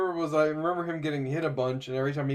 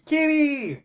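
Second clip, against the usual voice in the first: high, drawn-out mock child's cry; child calling out; 10 semitones higher, 3 dB louder than usual. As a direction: squeal it like a little kid calling. Imitates the child calling him.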